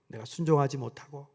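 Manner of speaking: usual speaking voice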